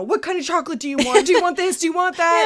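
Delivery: high, eager, fussing questions; doting aunt. Retold or imitated. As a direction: coax a hurt child with treats. Aunt fussing over burned child.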